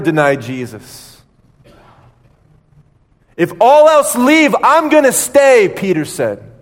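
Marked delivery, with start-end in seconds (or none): 3.4-5.7 much higher, louder proud promise; bold vow. Acted. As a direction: declare loyalty at full voice.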